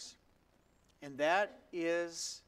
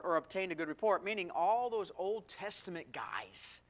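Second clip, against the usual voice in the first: ordinary delivery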